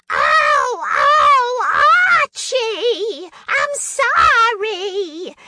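high, loud, whiny cry of a sitcom housewife, very slow at the end; Edith Bunker. Shown as a demonstration. On Carson, doing her for Johnny to guess, high yelping wail. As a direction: wail it up high like a put-upon wife yelping at her husband. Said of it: "Edith Bunker".

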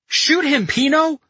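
very high, loud yelled order; urgent yell. A narrator's reading. Carletto urges Pino to fire on the general, yelling. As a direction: yell it to spur him on. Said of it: "Carletto yelled".

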